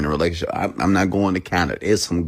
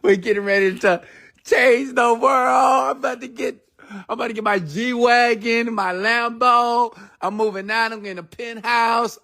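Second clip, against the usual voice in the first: much higher, elated daydream voice; giddy inner voice. Retold or imitated. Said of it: "in my mind, I'm like".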